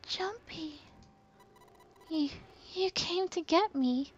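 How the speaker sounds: put-on high, soft, weak girl's voice; faint girl's voice. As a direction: barely get the words out, high and faint. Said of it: "he could barely hear her".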